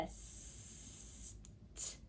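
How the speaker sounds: "s-t" as long hiss, then bare t; isolated sounds. Shown as a demonstration. Teacher confirms the tricky ending, "s-t" voiceless and drawn out. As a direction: sustain the s in "s-t", tap the t. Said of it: "this sound right before the t".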